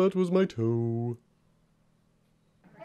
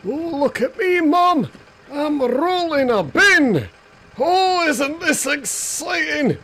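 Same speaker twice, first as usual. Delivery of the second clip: higher and louder, giddy squeals; excited child voice; 11 semitones higher, 5 dB louder than usual. Acted. A pup rolling a bin shouts to Mum, higher and giddy. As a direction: call out thrilled to Mum.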